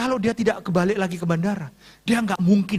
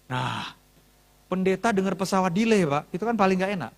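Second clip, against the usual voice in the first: ordinary talk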